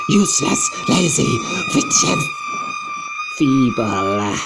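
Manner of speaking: high, loud, scornful witch rant; furious witch tirade. Acted. Grand High Witch scolding the audience, furious shrieks. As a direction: lash them with every insult. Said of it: "she yelled".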